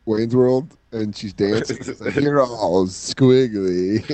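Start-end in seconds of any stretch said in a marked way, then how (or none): 2.1-4.0 slow drawn-out quote. Retold or imitated. Quoting Garth slowly.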